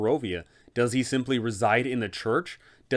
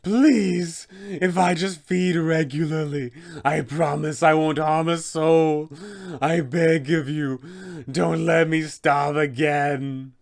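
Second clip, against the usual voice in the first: higher, slow desperate promise; pleading vampire spawn; 4 semitones higher, 3 dB louder, slower than usual. Acted. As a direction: beg the party to let you feed, drawn out. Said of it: "He will tell the party".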